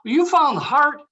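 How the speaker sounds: high, loud disbelieving shout; can't believe what he just heard; incredulous. Acted. Nick yelling at Art in disbelief about the heart, incredulous.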